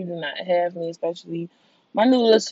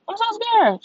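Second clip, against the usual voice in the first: much higher, mock-terrified squeal; high scared squeal; 4 dB louder than usual. Real, not acted. Dreading the vaccine, high mock squeal.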